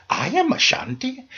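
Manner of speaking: put-on delighted Ghanaian accent, pitch leaping up high. Ghanaian bus rider lights up, high delighted Ghanaian accent. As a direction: imitate the Ghanaian man's thrilled, high Ashanti cry. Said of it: "I love African accents when speaking English".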